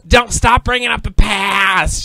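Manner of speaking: slow, high, loud protest; mock angry shout. Imitating friend mad at being called hypocrite, shouted. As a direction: shout each word in mock outrage. Retold quarrel, friend snaps.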